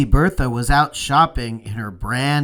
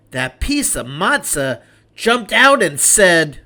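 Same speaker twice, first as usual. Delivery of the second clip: plain reading voice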